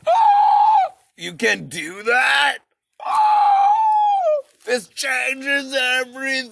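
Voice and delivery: squealing high shout of wonder; mock amazement. People discovering they can do that, excited squeal. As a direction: gasp it out like a revelation. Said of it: "people were like".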